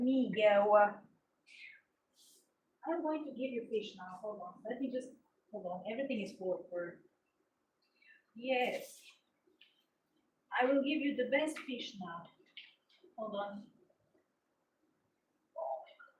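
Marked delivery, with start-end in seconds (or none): 2.9-13.6 soft hurried murmur; quiet muttering. Real, not acted. Mouth full of fur, quiet. 15.6-16.1 breathy whispered oh my God. Real, not acted.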